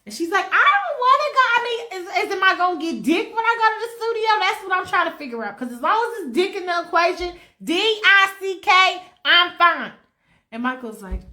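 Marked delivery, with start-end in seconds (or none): 0.4-9.9 high, loud, brassy demanding; sassy woman voice pestering; demanding diva. Acted. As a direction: press him, loud and impatient. She keeps pestering him.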